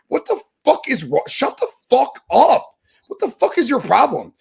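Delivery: high, loud, furious yelling; furious rant. Real, not acted. Host yelling in fury at fans who tweet about real wrestling. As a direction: blow up at the critics. Ranting at online wrestling critics.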